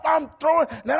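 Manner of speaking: normal talking voice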